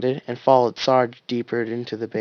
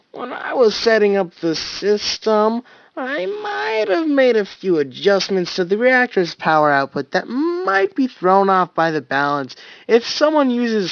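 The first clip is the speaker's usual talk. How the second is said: put-on sheepish childlike voice; 7 semitones higher, 5 dB louder than usual. Caboose voice explaining sheepishly.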